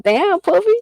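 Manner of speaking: high mock guy voice; lightly voiced drunk friend; friend's surprised reply. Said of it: "He goes".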